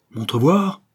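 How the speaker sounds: high, loud furious yell. A narrator's reading. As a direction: bark the demand across the fight. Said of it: "hurle-t-il". (crié fort, furieux)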